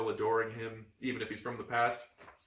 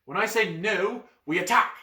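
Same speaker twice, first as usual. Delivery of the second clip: urgent battle plan; 8 semitones higher, 7 dB louder than usual. Acted. Boy planning ambush.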